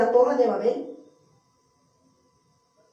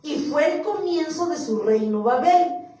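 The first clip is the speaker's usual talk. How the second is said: scripture reading; 3 semitones higher, 4 dB louder than usual. Acted. Preacher reading of Nimrod to the church, emphatic scripture reading. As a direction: declaim the verse firmly.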